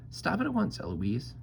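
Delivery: very high mocking echo; Eloise mimicking. A narrator's reading. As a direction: parrot the angry tutor up high. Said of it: "And then I say".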